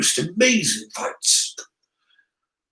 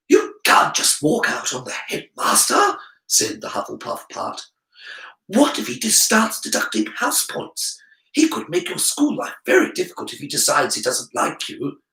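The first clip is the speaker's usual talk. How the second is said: lightly voiced fretful inner voice; worried Hufflepuff part; 6 semitones higher than usual. Harry's Hufflepuff part warning about the headmaster, mildly worried. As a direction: fret about getting into trouble.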